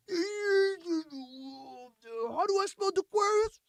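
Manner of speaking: very high, dopey confused voice; silly buyer voice. Retold or imitated. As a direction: play the clueless buyer at the contract. Buyer asks how to sign.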